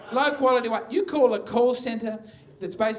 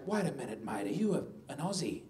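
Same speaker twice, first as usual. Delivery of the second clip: low and much softer, checking; suddenly subdued caller; 6 semitones lower, 9 dB quieter, faster than usual. Acted. Angry caller on hearing the agent's voice, suddenly subdued. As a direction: drop the voice and ask carefully.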